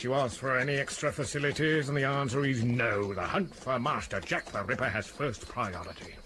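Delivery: normal talking voice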